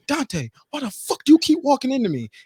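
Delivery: high-pitched imitation of her complaint; mock annoyed woman. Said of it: "and she's like".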